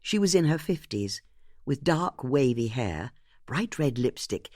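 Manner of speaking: ordinary delivery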